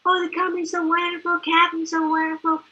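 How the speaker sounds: mock an excited fan's gushing; gushing imitation. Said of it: "He'd be going".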